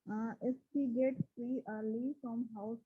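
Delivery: very faint, far from the mic; barely audible. Real, not acted. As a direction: voice almost lost, very low level. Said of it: "your voice is very low".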